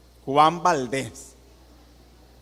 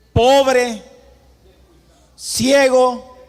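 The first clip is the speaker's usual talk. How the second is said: much higher, much louder cry; shouted verdict; 9 semitones higher, 9 dB louder, much slower than usual. Acted. Preacher shouting Jesus' rebuke at the congregation, a loud cry. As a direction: hurl each word at the hearers.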